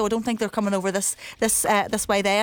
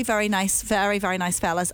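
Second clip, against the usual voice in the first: usual speaking voice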